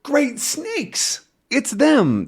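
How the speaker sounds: high, startled exclamation; startled cry. Acted. Tintin spots the crooks, a startled high cry. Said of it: "Tintin sees them and goes".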